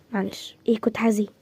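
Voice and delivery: ordinary talk, nothing marked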